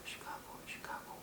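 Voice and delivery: timid whispered "시카고", almost no sound; barely audible practice. Shown as a demonstration. Teacher shows useless silent practice, barely audible whisper. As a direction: mumble it to yourself under your breath, whispering. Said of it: "이렇게 하는 건 별로 의미가 없어요".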